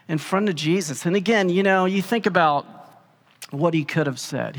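ordinary delivery

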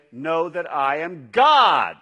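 "God" held long and loud at the end; stress on God. Shown as a demonstration. Preacher showing a second reading of the verse with stress on "God". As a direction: land the whole verse on "God". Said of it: "Or we could say".

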